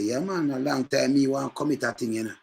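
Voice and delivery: ordinary delivery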